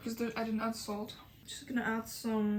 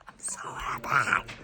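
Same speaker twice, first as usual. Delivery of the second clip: happy whisper; 11 semitones lower than usual. Real, not acted. Eating alone, whispering joy to camera. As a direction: breathe it out secretly.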